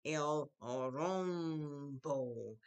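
"El rombo" very slowly, each vowel held long; drawn out. Shown as a demonstration. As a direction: stretch "El rombo" out for the class. Teacher models a new word.

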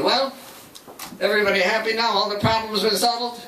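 upbeat wrap-up question to the room, a touch hammed; cheery group leader. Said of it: "leading the group said".

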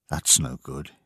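subdued musing; thinking to himself. A narrator's reading. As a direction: say it low, as a private thought. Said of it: "he thought".

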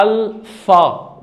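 "الفا" split slowly into al and fa; French pronunciation. Shown as a demonstration. Teacher builds the word from letters, slow French pronunciation. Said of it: "تتنطق كده".

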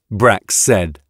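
ordinary delivery, nothing marked

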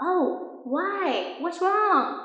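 high, concerned native speaker; asking what's wrong. Retold or imitated. Native speaker asking a friend what's wrong, high and concerned. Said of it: "native speakers usually ask you".

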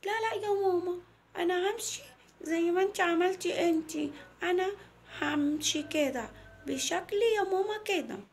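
put-on high child voice, refusing; insistent little girl. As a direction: dig in like a small girl saying no to mum. (صوت طفلة مصممة على رأيها)

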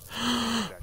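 short high gasp of disbelief; shocked gasp. Real, not acted. Gamer sees he got the PPSH, a sudden gasp. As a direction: gasp as it sinks in.

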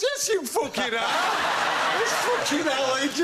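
high, drawn-out mock yell; stretched, high-pitched comic insistence; insistent yell. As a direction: imitate an overexcited pub bloke shouting. Bloke insists, acted argument.

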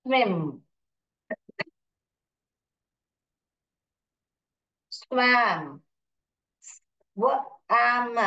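"स्विम" and "स्वैम" as isolated, held words; phonics sounding-out. Shown as a demonstration. Teacher showing pupils phonics reading, sounded out. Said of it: "पढ़ना आ रहा है सब फोनिक्स में".

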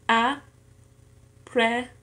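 very slow "aprehender", a, pre, hen, der; syllable by syllable. Shown as a demonstration. Learner showing four syllables in an exercise, "aprehender" syllable by syllable. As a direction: say "aprehender" in four pieces with long pauses. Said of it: "en cuatro sílabas".

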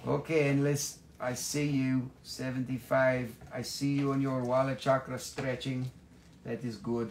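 ordinary talk

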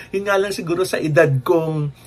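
ordinary delivery

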